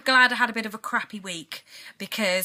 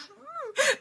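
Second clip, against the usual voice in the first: laugh-crying hum. Retold or imitated. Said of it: "and I'm like".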